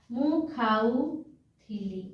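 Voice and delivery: drawn-out ମୁଁ ଖାଉଥିଲି, leaning on the ଉ sound; slow demo. Shown as a demonstration. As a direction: say ମୁଁ ଖାଉଥିଲି very slowly for the class. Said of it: "ଉ ର ଉଚ୍ଚାରଣ ହେବା". (ଧୀରେ ଧୀରେ ଉଚ୍ଚାରଣ)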